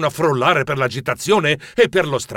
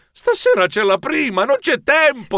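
high-pitched, agitated objection; panicky protest; 9 semitones higher than usual. Acted. Director to costume designer before opening night, panicky. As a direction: plead urgently, voice climbing. Tries to stop costume changes.

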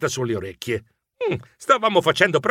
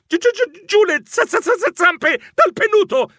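high-pitched panicky stutter; comic frightened squeak, stuttering; stammering; 4 dB louder than usual. A narrator's reading. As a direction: stumble repeatedly on first syllables, voice shot up.

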